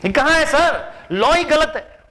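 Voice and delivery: mock disbelief. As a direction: act stunned at what the law says. Officer shocked at the law. (बनावटी हैरानी, ऊँची आवाज़ में)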